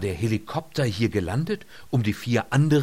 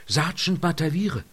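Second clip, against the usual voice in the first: higher-pitched call, close to sobbing; tearful cry; 7 semitones higher than usual. A narrator's reading. Lynott leans over the waking sergeant, tearfully calling. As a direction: cry out his name with emotion. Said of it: "Sie schluchzte".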